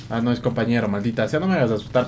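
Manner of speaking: normal talking voice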